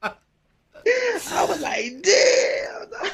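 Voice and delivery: high, stretched-out fake crying wail; mock anguished wail. Laughing at his cellmate's news with a hammed-up anguished wail. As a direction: wail it like your heart just broke, hamming it up. Mock wailing over sentence.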